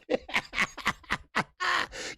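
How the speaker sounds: long high cackle; high cackling laugh. Real, not acted. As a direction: cackle with glee. Long laugh after his joke.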